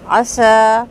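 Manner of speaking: عصا with a heavy mouth-filling صاد; full-mouth saad. Shown as a demonstration. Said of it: "پورا منہ جو ہے وہ بھر کے صاد ادا ہوا ہے". (عصا، منہ بھر کے صاد)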